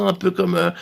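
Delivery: ordinary delivery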